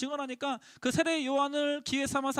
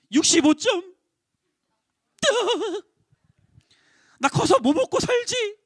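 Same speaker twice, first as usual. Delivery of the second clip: higher, louder student voice; whining student; 8 semitones higher, 5 dB louder than usual. Retold or imitated. Preacher imitating a teen upset about grades, higher and louder. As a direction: complain like a teen over bad grades. Student laments test score.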